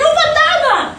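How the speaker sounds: high startled yelp, half laughing; mock frightened cry. Preacher mimics disciples seeing him: mock scared cry. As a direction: play the scared disciples, a bit hammed-up.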